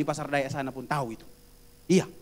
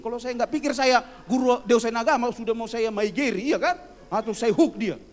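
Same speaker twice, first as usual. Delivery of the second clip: mock anger; 4 semitones higher, 7 dB louder than usual. Recalls wanting to hit student.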